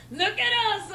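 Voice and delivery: ordinary talk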